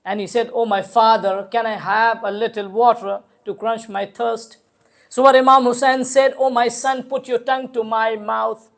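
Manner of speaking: level, intoned lament; mournful narration. Acted. Preacher tells of thirsty son and father, mournful recitation. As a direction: build gradually as the elegy goes on.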